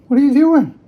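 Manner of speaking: high, bright dog-talk question; playful question to a puppy. Real, not acted. Owner talking to her dog.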